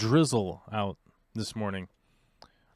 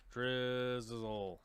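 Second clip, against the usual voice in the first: soft, drawn-out word; 8 dB quieter than usual. Real, not acted. Streamer putting drizzle into the translator, soft and drawn out. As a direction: stretch it softly as you type.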